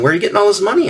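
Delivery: high, mock-suspicious mom voice; lightly voiced nagging mother; mom quizzing. Mom asking her son where the money comes from, mildly suspicious. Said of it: "my mom was always saying".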